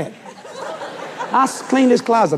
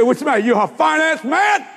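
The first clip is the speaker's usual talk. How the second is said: mocking taunt; 8 semitones higher than usual. Salesman taunting, shouted.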